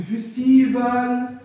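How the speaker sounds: loud, drawn-out "فيستيفال" with a clear final L; final L sounded. Shown as a demonstration. Teacher modelling for pupils, "فيستيفال" stretched with final L sounded. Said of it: "يبقى الآخر دايمًا بتتنطق في الآخر".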